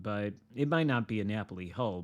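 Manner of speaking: ordinary delivery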